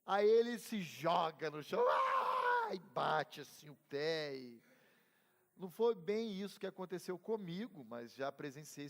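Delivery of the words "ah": high piercing tantrum shriek, loud; child's tantrum scream. Retold or imitated. Imitating a child flinging himself on the floor, loud high tantrum scream. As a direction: shriek like a kid throwing a fit.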